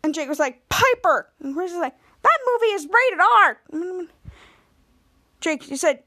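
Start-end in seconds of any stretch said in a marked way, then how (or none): 0.7-1.3 high, sharp mock scolding; acted-out scandalised parent. 2.2-3.6 high, raised-voice mock outrage; imitated parental telling-off; scolding. Acted parent scolding Piper.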